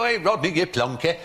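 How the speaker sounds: badly faked Cockney heckle; bad mock Cockney. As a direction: imitate Yorkshire lads botching a Cockney accent. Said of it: "a rather bad approximation of a Cockney accent".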